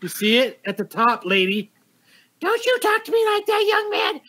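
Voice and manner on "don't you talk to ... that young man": much higher scolding mom voice; heated family shouting. Pretend. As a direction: scold like an offended mother, pitched way up. Mock parent scolding a kid.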